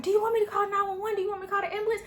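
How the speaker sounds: very high, fast frantic questions; panicked mom. Retold or imitated. Mom grabbing her phone asks about calling, frantic high voice. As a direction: fire off questions in a retold panic.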